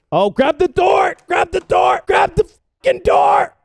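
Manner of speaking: high, slow loud yelling; shouting. Real, not acted. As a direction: yell it in frantic urgency. Urgent in firefight.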